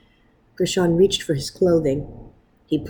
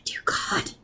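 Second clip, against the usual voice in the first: breathless prayer; 11 dB quieter than usual. A narrator's reading. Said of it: "he cried".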